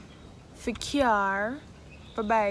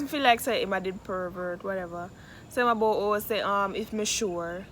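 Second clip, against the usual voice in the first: ordinary talk, nothing marked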